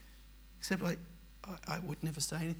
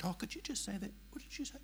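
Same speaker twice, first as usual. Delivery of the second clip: high, soft, falsely sweet inquiry; mock-innocent high question; mock sweet asker; 6 semitones higher, 7 dB quieter than usual. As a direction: voice a saccharine church elder. Mimics pastor's sweet question.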